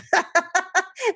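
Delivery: a short high laugh at herself; high amused laugh. Real, not acted. Teacher laughing at her own example, short laugh. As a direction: laugh it off lightly.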